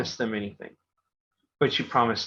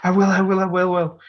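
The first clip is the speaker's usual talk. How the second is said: eager promise; 7 semitones higher, 6 dB louder than usual. Retold or imitated.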